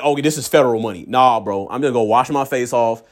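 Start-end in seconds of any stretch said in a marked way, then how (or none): 0.0-1.0 rushed warning. Retold or imitated. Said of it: "they're like".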